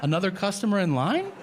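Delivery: high, rising disbelief; incredulous. Retold or imitated. Customer doubts the cashier's suggestion, incredulous. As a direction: question it with pitch climbing.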